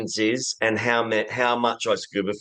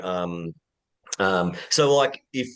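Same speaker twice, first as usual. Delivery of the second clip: usual speaking voice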